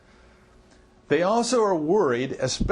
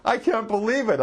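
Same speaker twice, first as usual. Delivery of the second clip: pleased disbelief; 7 semitones higher than usual. Retold or imitated. Diffused customer suddenly pleased.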